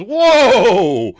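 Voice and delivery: loud, high startled yell; yelled whoa. Retold or imitated. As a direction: shout it out in alarm.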